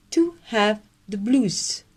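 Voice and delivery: slow, nose-up British accent; posh English accent. Shown as a demonstration. Teacher showing members the British accent. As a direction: lift the upper lip and speak like a Brit, slowly. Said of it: "parler british et avoir le super accent".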